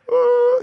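high sobbing ooh; a choked high wail; high emotional ooh. Retold or imitated. Pastor crying as church planters leave, high wail. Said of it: "I'm sitting there going".

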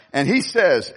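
usual speaking voice

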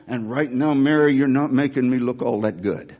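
grumbling at sister. Acted. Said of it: "Martha and me".

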